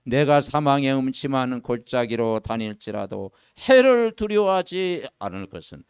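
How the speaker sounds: slow, loud, measured recitation; solemn scripture reading. Acted. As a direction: read the psalm slowly and gravely. Reads psalm aloud. (천천히 장엄한 성경 낭독)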